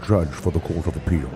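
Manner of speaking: low, lightly done Scottish Sean Connery voice; Sean Connery accent. Acted. Guest asked to do Sean Connery, low mock Scottish accent. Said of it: "can you do a Sean?".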